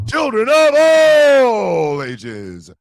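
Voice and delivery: loud, stretched ringmaster opening; announcer intro. Acted. Host opening the show to viewers, announcer style. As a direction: open the show like a circus ringmaster.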